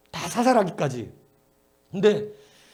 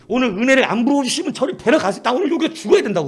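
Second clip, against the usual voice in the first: loud desperate plea to God; pleading prayer; 7 semitones higher, 7 dB louder than usual. Retold or imitated. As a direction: cry out to God, staking your life. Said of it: "그때는 막 무슨 용기가 있는지".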